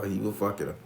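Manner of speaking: ordinary delivery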